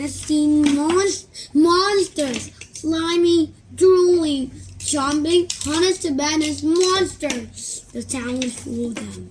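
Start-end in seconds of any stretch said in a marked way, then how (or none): 0.0-7.9 louder, higher spooky storytelling; dramatic build-up. A narrator's reading. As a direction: pile on the monsters. Narrator building monster list.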